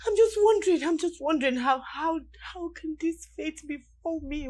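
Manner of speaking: loud, anguished outburst; distraught cry. Acted. Wife breaks down before her husband, anguished and loud. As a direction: let the anguish burst out.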